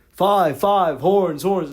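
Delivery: loud, high yelled play calls; shouting. Retold or imitated. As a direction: call the plays out over the crowd. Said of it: "I would scream it".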